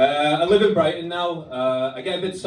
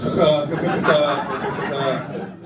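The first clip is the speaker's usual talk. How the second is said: rasping guttural throat noise from the back; guttural throat sound. Shown as a demonstration. Comic mocking students' speech, guttural throat noise. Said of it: "I also use a lot of".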